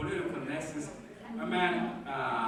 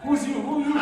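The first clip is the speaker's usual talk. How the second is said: teasing comeback; 6 semitones higher, 9 dB louder than usual. Real, not acted. Teasing heckler back.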